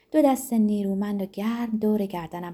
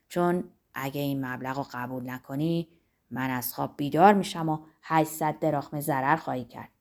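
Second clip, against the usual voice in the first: lower-voiced Zorba, amused storyteller; a lightly lowered voice, telling a funny dream; 7 semitones lower than usual. A narrator's reading. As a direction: Zorba wakes the boss to tell his odd dream, amused. Zorba recounts his dream.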